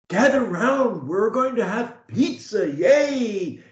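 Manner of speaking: high, cheery party call; excited announcement. Shown as a demonstration. Coach demonstrating a second emotion, happy party invitation. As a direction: call everyone in for pizza, thrilled. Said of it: "That's one kind of emotion".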